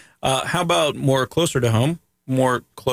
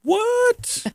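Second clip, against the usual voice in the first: mock surprise. Mock shock at everyone directing.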